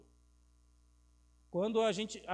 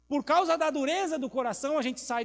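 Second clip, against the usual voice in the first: loud emphasis; 6 semitones higher, 7 dB louder than usual. Real, not acted. Preacher emphasizing.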